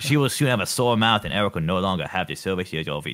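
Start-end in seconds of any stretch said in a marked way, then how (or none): 0.0-1.2 lightly voiced Kennedy, higher and louder; Kennedy impression. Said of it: "try my best to do Kenny impression".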